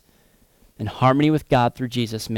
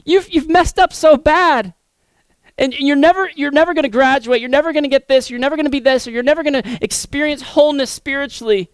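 mock accuser; 12 semitones higher, 4 dB louder than usual. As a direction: sneer it down at them like a bully. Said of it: "somebody coming up to you and saying".

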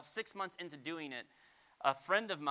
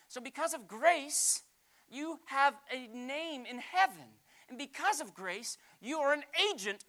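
higher and slow, ringing; emphatic preaching; 8 semitones higher, 5 dB louder than usual. Real, not acted. Pastor telling the church of heaven, emphatic. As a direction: declare it slowly.

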